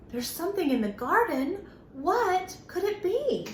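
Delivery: gasping, fast and curious; wide-eyed wonder at a mystery; surprised. Acted. Gasp, teasing kids with a mystery.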